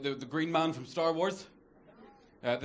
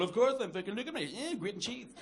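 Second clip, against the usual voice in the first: higher, softer mock reply; mocked fit audience member; 5 semitones higher, 4 dB quieter than usual. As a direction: imitate a smug fit man under your breath. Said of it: "very fit man in the front row going".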